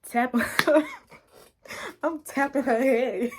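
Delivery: breaking up with laughter mid-phrase; laughing while talking. Real, not acted. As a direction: crack up as you tell it. Cracks up telling the fight.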